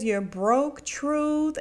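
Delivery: drawn-out, slow mocking threat; slow taunt. [name] taunting Karen at dinner, slow and mocking. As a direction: savour each word as a threat.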